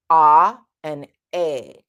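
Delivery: slow loud "Ah" then "A", only the tongue moving; ah versus A. Shown as a demonstration. Coach contrasting two vowels for singers. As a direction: contrast "Ah and A" slowly. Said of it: "is the position of the tongue".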